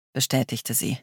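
usual speaking voice

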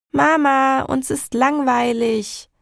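high, drawn-out bored whine; child calling from upstairs. A narrator's reading. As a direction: call out to mum like a bored kid. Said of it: "hörte ich Tom von oben rufen".

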